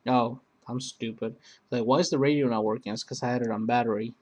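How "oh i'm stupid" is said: ordinary delivery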